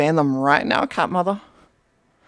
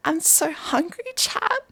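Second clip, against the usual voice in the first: high begging kitten voice; 9 semitones higher, slower than usual. Pretend. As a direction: beg for food like a hungry kitten. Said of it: "this will now be my impression of a cat mom stream".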